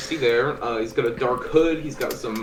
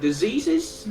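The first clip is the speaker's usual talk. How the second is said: polite adventurer; 6 semitones higher than usual. Acted. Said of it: "I step in and go".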